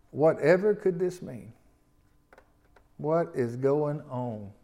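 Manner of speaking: slow, drawn-out bewilderment; amazed and perplexed. Acted. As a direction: wonder it aloud slowly, mildly baffled. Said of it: "they were all amazed and perplexed".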